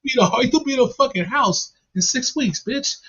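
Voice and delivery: high mocking voice.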